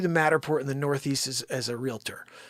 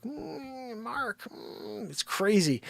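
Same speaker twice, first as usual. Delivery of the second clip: mock pity; 8 semitones higher, 6 dB quieter, much slower than usual. Mocking company's pitying brush-off.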